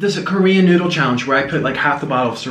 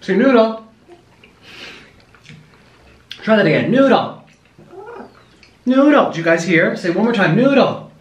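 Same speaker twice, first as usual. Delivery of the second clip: high coaxing pet voice; 5 semitones higher than usual. Real, not acted. Coaxing bird to talk.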